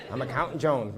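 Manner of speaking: normal talking voice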